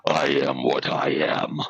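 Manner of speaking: low, slow Popeye growl; deadpan Popeye. Retold or imitated. Popeye to the camera, a low slow deadpan line. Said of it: "dead ass to the camera goes".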